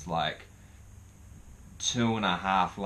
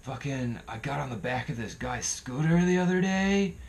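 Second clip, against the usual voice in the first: higher-pitched put-on voice of another bloke; injured guy retelling; 6 semitones higher than usual. Injured guy explaining his scooter crash, imitated in a higher voice. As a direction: mimic a battered guy telling his woes. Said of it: "And he's like".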